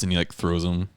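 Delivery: usual speaking voice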